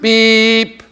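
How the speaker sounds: long, monotone electronic beep; sustained mock phone alarm; imitated phone beep. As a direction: hold one loud, level tone like an alert. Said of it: "your phone goes beep".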